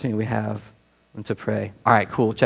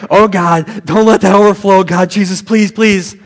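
louder, higher pleading; mock desperate prayer; 6 semitones higher, 7 dB louder, slower than usual. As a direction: beg God the urinal won't overflow. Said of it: "I was like".

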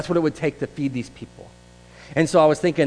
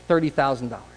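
normal talking voice

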